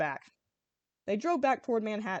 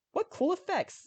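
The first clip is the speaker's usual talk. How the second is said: much higher delighted exclamation; exclaimed; 9 semitones higher, slower than usual. A narrator's reading. Cyril watching the fight on TV, delighted. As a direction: gush at the screen. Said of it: "Cyril exclaimed".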